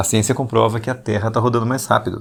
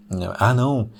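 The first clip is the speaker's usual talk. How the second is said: ordinary talk, nothing marked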